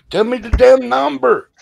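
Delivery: high, loud exasperated yell; mock hollering. Imitating an angry caller demanding a number, hollering. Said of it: "they'll be hollering at you".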